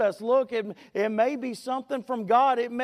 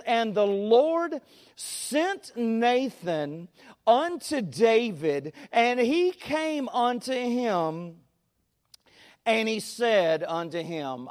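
slow, measured Bible reading; scripture reading; slower than usual. Acted. Preacher reading the verse to his congregation, slow and solemn. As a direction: read the verse slowly and solemnly.

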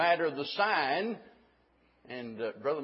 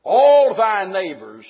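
All drawn out slow and loud, much louder; stress on all; 3 semitones higher, 12 dB louder, slower than usual. Shown as a demonstration. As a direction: lean hard and slow on the word All. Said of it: "emphasize that word all".

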